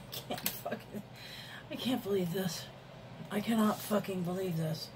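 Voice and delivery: soft, disbelieving swearing to oneself; hushed, real upset; dismayed muttering. Real, not acted. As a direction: curse under your breath, away from the mic.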